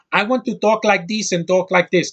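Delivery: mock Colombian accent. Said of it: "That's her accent in every freaking movie".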